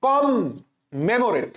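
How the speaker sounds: "কম", pause, then "মেমোরেট"; broken into parts. Shown as a demonstration. Teacher breaks the word for spelling, "কম" apart from "মেমোরেট". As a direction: split it as kom + memorate. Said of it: "আমি কিসের মতো উচ্চারণ করছি?".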